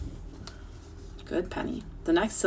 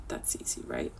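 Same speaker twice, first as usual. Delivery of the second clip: whisper; 13 dB quieter than usual. Real, not acted. Teacher whispers aside.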